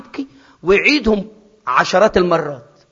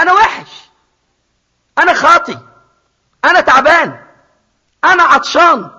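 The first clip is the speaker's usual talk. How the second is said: voicing the lost son; 11 semitones higher, 8 dB louder than usual. Preacher voices the lost son's despair.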